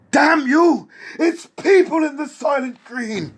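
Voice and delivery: high, anguished movie-hero shout; hammed-up screaming film climax; Charlton Heston. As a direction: wail it at full volume in a melodramatic impression. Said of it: "Let me do my Charlton Heston".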